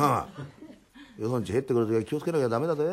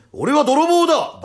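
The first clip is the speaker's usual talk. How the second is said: slow, high, booming announcement; big loud voice; 14 semitones higher, 11 dB louder, much slower than usual. Acted. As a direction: bellow it out to intimidate. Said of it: "バカじゃねえか、でかい声で". (大声でゆっくり名乗りを上げる)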